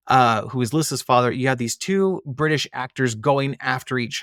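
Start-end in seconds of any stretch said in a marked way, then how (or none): none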